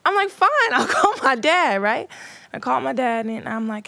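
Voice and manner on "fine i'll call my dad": high, loud comeback; imitated huffy defiance. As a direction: act the kid huffily taking up the challenge. Young self gives in defiantly.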